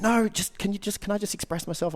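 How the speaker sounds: high-pitched imitation, loud first word; protesting plea. Partner refusing ideas on fixing it, protesting plea.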